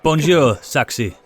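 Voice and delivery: mock French greeting; French accent. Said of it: "in his French accent".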